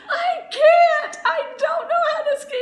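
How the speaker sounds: high, loud, laughing protest; desperate admission. Retold or imitated. Beginner telling classmates she can't ski, high desperate laugh.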